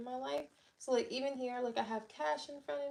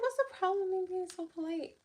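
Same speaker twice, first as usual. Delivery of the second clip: indignant retort; 8 semitones higher, faster than usual. Retold or imitated. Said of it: "I was like".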